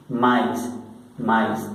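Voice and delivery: "maíz" run together as ma-is, ending in S; French "maíz": two vowels, no pause between; both vowels sounded. Shown as a demonstration. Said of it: "se va a pronunciar por separado". ("maíz" seguido, ma-is, sonando las dos vocales)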